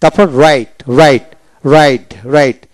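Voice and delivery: normal talking voice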